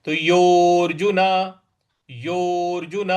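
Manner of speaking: योर्जुन with the यो drawn out long. Shown as a demonstration. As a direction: stretch the यो of योर्जुन. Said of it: "इस प्रकार से बोलेंगे". (योर्जुन में यो को दीर्घ खींचें)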